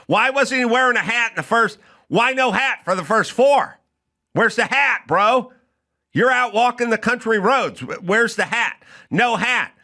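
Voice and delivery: high-pitched, insistent comic rant; mock indignation. Comic asking why no hat for the first four, mock indignant.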